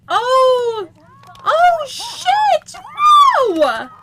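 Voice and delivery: loud, high-pitched outburst of shock; shocked shout. Real, not acted. Gamer watching the killing blow land, shocked shouting. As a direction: yell it out in sudden shock.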